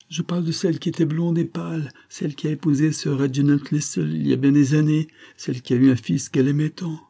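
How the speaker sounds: high-pitched, insistent invalid; delirious sick woman, insistent. Acted. Sick woman explains which lady she means, insistent. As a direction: insist feverishly, voice held up high.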